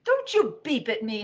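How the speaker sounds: high, loud indignant yell; angry holler. Acted. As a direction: holler back at the robot. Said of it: "hollered Rabbit".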